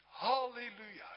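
high, loud hallelujah shout; jubilant cry. Retold or imitated. The redeemed man crying out among the churchgoers, jubilant. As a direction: cry it out in pure joy. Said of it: "riep hij uit".

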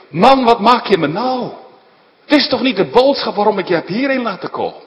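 loud, high, fast reproach; exasperated rebuke. Acted. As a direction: scold him in disbelief. Balak rebuking Balaam.

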